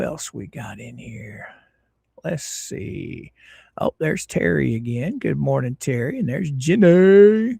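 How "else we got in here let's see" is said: soft whisper to himself; hushed whisper while scanning the chat. Real, not acted. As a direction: whisper it like a secret aside.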